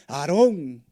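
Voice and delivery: high, loud demand; people demanding. Retold or imitated. Israelites pressing Aaron for a calf, loud demand. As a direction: demand it like an impatient crowd.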